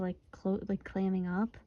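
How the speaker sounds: normal talking voice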